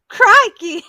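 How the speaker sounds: high, loud Australian exclamation; Australian accent. Shown as a demonstration. Giving friend the Australian one on request, loud mock Australian accent. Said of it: "give me the crikey, an Australian one".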